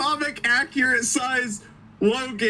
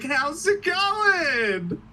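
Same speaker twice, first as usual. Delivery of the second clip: very slow and drawn out, a little higher; Deadpool's lazy hello; mock casual greeting; 6 semitones higher, much slower than usual. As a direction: drag it out, deadpan. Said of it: "And he's like".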